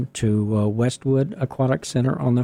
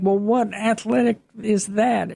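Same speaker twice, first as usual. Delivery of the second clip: skeptical; 8 semitones higher than usual. Pretend. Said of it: "And you might think".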